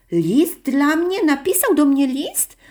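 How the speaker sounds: high, amazed questions, first one drawn out; surprised. A narrator's reading. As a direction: ask in happy disbelief. Girl learns of unexpected letter.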